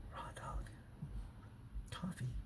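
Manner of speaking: hushed, stunned echo of the words; baffled whisper. Retold or imitated. Retelling a date's coffee order, whispered in disbelief. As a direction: mouth it in disbelief.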